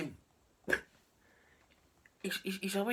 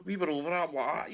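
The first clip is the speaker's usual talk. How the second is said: normal talking voice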